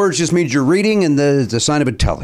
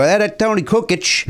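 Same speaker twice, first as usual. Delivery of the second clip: put-on slow, higher Chicago accent, "KOO-kitch"; Chicago fans; 7 semitones higher than usual. Imitating Chicago fans naming the player, Chicago accent. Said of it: "in Chicago they go".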